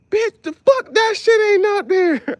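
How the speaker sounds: high, loud, slow exasperated yelling; mock outraged yell. Mad at a teammate's Family Feud answer, high loud yell. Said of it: "I'm like".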